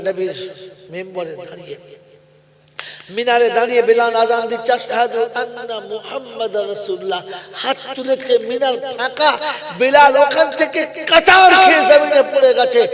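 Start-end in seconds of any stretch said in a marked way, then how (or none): 3.2-12.8 loud, high, impassioned sermon chant; full-throated dramatic preaching; preaching at full force. Real, not acted. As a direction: build to a loud, high climax.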